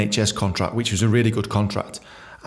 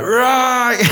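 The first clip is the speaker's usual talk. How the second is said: gruff landlord call; 12 semitones higher, 4 dB louder than usual. Retold or imitated. Jack seals the deal.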